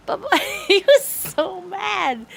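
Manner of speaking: laughing. Real, not acted. Laughing while retelling the story.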